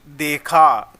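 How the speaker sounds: देखा slowly, with a long final "aa"; देखा ending on a held "aa" sound; stressed आ ending. Shown as a demonstration. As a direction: stretch the last vowel of देखा. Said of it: "last में जो sound आ".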